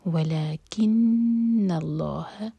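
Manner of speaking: ولیکن slow with held nasal نون; ghunna on nun. Shown as a demonstration. Teacher repeats ولیکن اللہ to model the ghunna. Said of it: "تو نون کو hold کریں گے".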